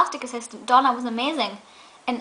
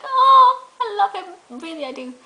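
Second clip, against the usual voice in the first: hammed-up swooning; 9 semitones higher, 3 dB louder, slower than usual. Fan whose heart just dropped, swooning in mock wail. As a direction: gush like a heart-melted fan. Gushing over a longing look.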